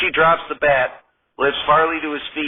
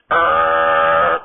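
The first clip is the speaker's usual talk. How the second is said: long monotone "errr" like a buzzer; droning voiced buzz on one note; wrong-answer buzzer; 3 dB louder than usual. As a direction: imitate a game-show buzzer.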